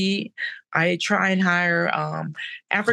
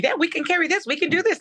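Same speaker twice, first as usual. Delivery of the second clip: much higher, eager team voice; a lightly voiced confident team; team's eager voice; 11 semitones higher, much faster than usual. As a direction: chirp it like the eager new team. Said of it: "they just went".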